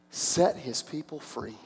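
normal talking voice

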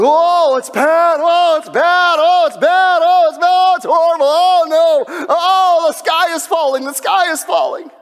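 high, loud alarmist shouting; hammed-up sky-is-falling panic; mock panicked newscaster. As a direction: ham up a news anchor crying doom. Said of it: "I turn it on".

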